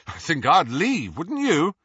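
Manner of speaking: rising, loud scoff; high, brash boyish disdain; mocking disdain. A narrator's reading. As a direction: scoff to a friend, pitch climbing. James mocks Slytherin to his friend.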